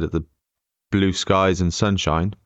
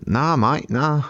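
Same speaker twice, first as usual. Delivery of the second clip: higher-pitched mock Aussie; amateur Australian accent, pitched up; attempted Australian accent; 5 semitones higher, much slower than usual. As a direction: imitate an Australian guard's indignant retelling. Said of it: "It's still not Australian".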